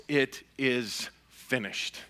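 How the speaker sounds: slow, softened, weighty delivery; solemn quiet quote. Retold or imitated. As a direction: let the quoted words fall softly and slowly. Solemn quote of Jesus' last words.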